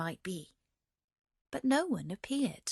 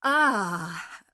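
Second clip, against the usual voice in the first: anguished cry; 7 dB louder than usual. Acted. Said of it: "cried the merchant".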